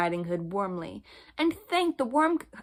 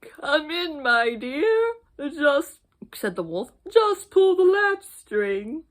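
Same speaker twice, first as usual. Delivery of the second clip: higher, slow mock granny voice; wolf faking granny; 7 semitones higher, 5 dB louder, slower than usual. Wolf inviting the girl in, faking granny's voice. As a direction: drag it out sweetly as the old lady. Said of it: "sweet and quavery".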